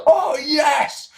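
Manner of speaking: high, drawn-out mock cheer; excited crowd reaction. The whole world sits up as the trailer runs, excited. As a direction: imitate a whole crowd perking up in delight. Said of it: "whole world sort of sits up and goes".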